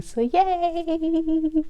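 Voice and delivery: a high, soft little cheer; a light, high yay; cheerful yay. Real, not acted. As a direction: squeal it happily. Cheering after the invitation to join.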